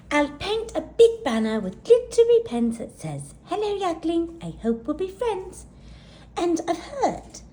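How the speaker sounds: high, eager little yak voice; a high excited young yak; little yak girl. Acted. Gertie's voice, small eager yak.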